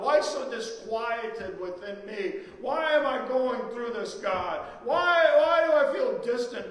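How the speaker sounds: loud, high, pleading cry to God; desperate psalmist wailing at God; anguished crying out. Acted. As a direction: cry out each why in anguish. Preacher voices David crying out.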